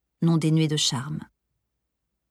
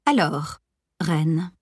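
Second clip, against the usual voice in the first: honeyed, coaxing tone; sugary voice; much slower than usual. A narrator's reading. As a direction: sweet-talk it with a hint of mischief. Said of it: "commença-t-elle d'une voix doucereuse".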